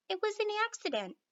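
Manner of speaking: plain reading voice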